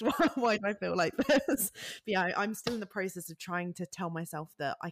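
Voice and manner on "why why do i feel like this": laughing mock bewilderment. Laughingly retells confusion at crying.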